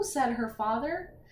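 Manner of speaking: plain reading voice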